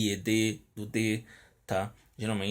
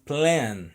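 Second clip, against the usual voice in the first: "Plan" punched higher and louder, with a falling end; stressed at the start; 4 semitones higher, 5 dB louder than usual. Shown as a demonstration. Teacher repeats the beginning stress for students, stressed at the start. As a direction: land hard on the opening of "Plan". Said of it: "No começo".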